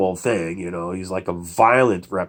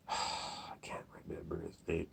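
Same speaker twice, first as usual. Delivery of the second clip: near-silent whisper to himself; whispered aside; 4 semitones lower, 25 dB quieter than usual. Real, not acted. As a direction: trail into a whisper, searching your memory. Trying to recall a forgotten name.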